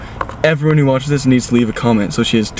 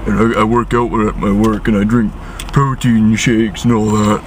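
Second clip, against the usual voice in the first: deep jock voice. Retold or imitated. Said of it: "her voice got all deep like this".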